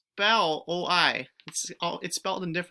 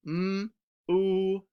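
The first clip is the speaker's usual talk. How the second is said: usual speaking voice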